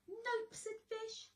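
high squeaky fish voice. Acted. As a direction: stay in the little fish voice. Fish voiced, tag carried along.

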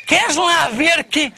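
drawn out with a laugh; a slow laughing double-take; slow laughing disbelief. Retold or imitated. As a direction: stretch it out, laughing. Teller acts his surprise.

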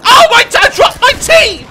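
high, loud wail of dismay; distraught outcry. Real, not acted. Briton who has just dropped his tea, loud distraught wail. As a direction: wail as if all is lost. Mock disaster over spilled tea.

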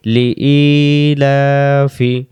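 slow, drawn-out chanted recitation of each syllable; slow Quran recitation. Shown as a demonstration. Teacher reciting slowly for students to repeat.

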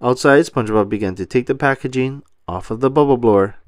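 usual speaking voice

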